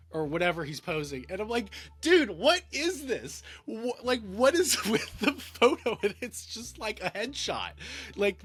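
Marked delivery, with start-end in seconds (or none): 2.0-6.0 loud, high, drawn-out incredulity with a laugh; exasperated, laughing "what on earth"; mock disbelief.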